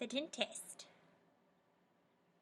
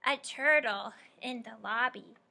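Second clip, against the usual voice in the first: normal talking voice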